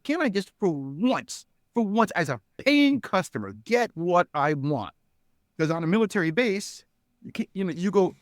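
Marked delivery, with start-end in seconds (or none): 0.0-4.9 slow, higher retold plea; exasperated plea. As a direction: plead like a fed-up customer, drawing it out. Retold fed-up plea as customer.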